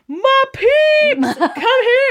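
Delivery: high, loud, laughing welcome. Retold or imitated. Singer greeting his fans at the convention, high, laughing welcome. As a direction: imitate a star greeting fans with joy. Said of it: "and he's like".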